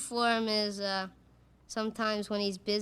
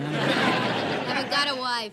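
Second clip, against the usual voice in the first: laughing; 3 semitones higher, 7 dB louder, much slower than usual. Real, not acted. Contestant laughs answering host.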